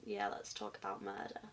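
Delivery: soft, breathy whisper with a rise on murder; mock spooky whisper. Opening the murder podcast segment, playful hushed whisper. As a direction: tease the creepy topic in a hush. Starting the true crime topic.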